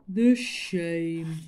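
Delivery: loud, booming lament; mournful elephant. Acted. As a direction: grieve your dignity.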